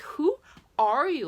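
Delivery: high, loud, slow disbelief; incredulous. Retold or imitated. Daughter shocked at her laughing mom, high and loud. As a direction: ask it like you can't believe her. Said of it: "I'm like".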